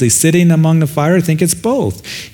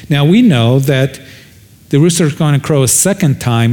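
normal talking voice

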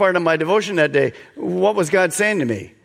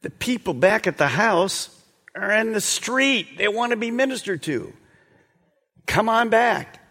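urgent disciples; 6 semitones higher than usual. Acted. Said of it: "they say to him".

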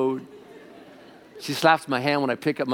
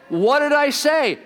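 high, loud, slow mock protest; mock indignant husband; 14 semitones higher, 7 dB louder, slower than usual. Husband protesting his wife's complaint, mock indignant. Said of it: "heard my wife say".

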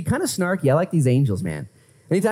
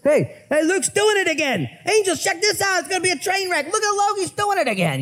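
much higher, gossipy angel voices egging each other on; mocking gossipy angels; 13 semitones higher than usual. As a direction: play a nosy angel calling the others over to watch. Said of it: "And the angels are like".

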